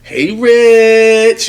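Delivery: high, stretched-out sultry "Hey, Rich"; drawn-out flirty name call. Retold or imitated. Woman stretching his name at his job, loud and flirty.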